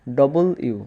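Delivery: "ডবল ইউ" with both words fully sounded; W as two words. Shown as a demonstration. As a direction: keep "ইউ" apart after "ডবল". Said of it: "সোজা কথা".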